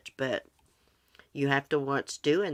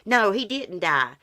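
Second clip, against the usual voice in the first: high, loud, hurried correction to a viewer; insistent, raised-voice clarification; emphatic denial; 6 semitones higher, 5 dB louder than usual. Real, not acted. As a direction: set them straight at once, pitched up. Correcting a viewer's mistaken condolence.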